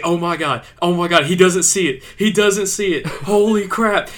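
can't believe the buck walks past; excited disbelief. Retold or imitated. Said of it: "keeps saying".

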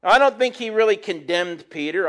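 ordinary talk

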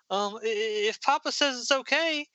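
high, stammering and unsure; acted coy uncertainty; bashful hesitation. Mermaid answering Dirk's invitation, bashful high stammer. Shy mermaid accepts date, read aloud.

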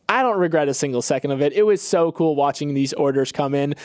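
usual speaking voice

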